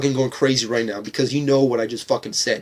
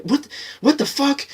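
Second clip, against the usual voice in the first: mock-baffled outburst rising to a high squeak; imitating confused moviegoers, pitch climbing; baffled fans; 8 semitones higher than usual. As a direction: play the stunned fan who can't believe it. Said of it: "People are like".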